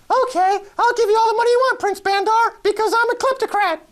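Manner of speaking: very high-pitched mock British accent; Tony Blair. Blair gives in to the prince's demand, silly British accent. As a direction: squeal it in a hammed-up British accent. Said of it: "That's my best British accent".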